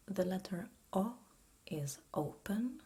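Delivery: ordinary delivery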